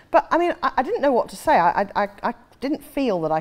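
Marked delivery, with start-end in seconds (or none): none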